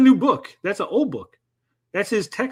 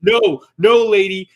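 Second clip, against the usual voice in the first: louder, emphatic brush-off of a complainer; loud, patronizing "no" in a retold exchange; firm mock refusal; 8 dB louder, slower than usual. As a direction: put your foot down, imitating unhelpful staff. Acting out staff refusing a request.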